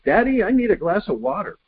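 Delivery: put-on the kid asking yet again; little boy voice.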